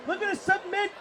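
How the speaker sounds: high, urgent submission call; excited play-by-play. Real, not acted. Commentator calling a sharpshooter submission attempt, urgent. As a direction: keep the pitch up as the hold drags on.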